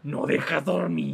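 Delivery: slow, drawn-out mock scolding mom; mom's fed-up nighttime complaint, slowly; imitating mom.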